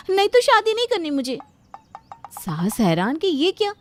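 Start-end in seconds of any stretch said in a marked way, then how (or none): 0.1-1.4 high, louder burst of protest; insistent refusal. Acted. Bride begging to keep her veil on, high loud insistence. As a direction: protest in a raised, higher voice.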